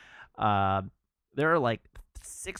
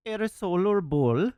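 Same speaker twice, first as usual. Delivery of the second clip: a loud, higher mock Swedish accent; Swedish chemist voice; 7 semitones higher, 7 dB louder than usual. Swedish chemist asks the Englishman, loud mock Swedish accent. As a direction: ask it like a heavy-accented Swedish shopkeeper. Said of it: "the Swedish chemist says".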